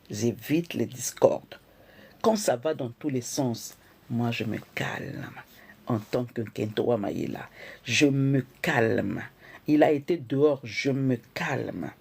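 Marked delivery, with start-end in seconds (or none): 4.1-11.9 slow, settled calm voice. Shown as a demonstration. Showing how a wife stays calm, slow and settled. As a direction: let every word slow down.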